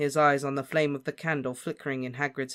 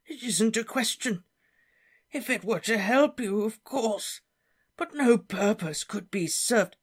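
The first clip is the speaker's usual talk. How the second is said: put-on much higher, wavering old professor; flustered Slughorn; 8 semitones higher than usual. Slughorn dodging Harry's request. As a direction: evade the demand, flustered.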